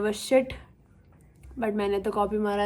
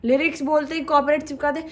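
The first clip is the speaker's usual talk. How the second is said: usual speaking voice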